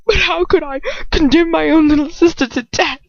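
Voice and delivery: high, strained and tearful; voice cracking. Acted. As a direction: let the voice crack on each word. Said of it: "His voice cracks".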